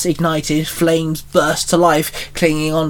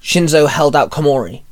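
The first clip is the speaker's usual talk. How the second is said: ordinary delivery, nothing marked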